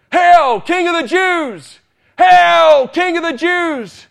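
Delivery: high, loud jeering shout; mocking hail. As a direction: hail him in loud mockery. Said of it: "Make fun of him and mock him".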